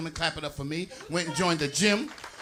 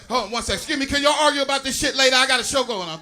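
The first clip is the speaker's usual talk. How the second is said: fast, louder scolding of the crowd; real annoyed snap at the audience; irritated aside; 5 semitones higher, 4 dB louder, faster than usual. Real, not acted. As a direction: tell the arguers off quickly. Heckler audience argues during set.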